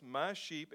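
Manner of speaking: "my" pressed with a falling tune in "my sheep"; stress on my. Shown as a demonstration. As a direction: lean on "my" before "sheep". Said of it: "notice that phrase".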